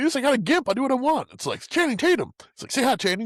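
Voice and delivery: high, loud cocky boast; mock swaggering villain. Friend imitating the cannibal bragging about his gimp. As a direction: brag it up high like a gleeful psycho. Imitating Danny McBride's character.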